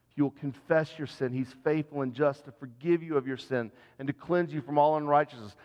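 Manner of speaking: ordinary delivery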